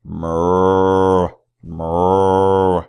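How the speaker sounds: a long, steady mooing cow. Acted. Reading to children, the cow moos steadily. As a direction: moo like a friendly cow for the kids.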